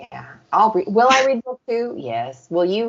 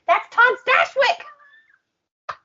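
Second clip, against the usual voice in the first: laughing reveal; much slower than usual. Retold or imitated. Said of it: "I was like".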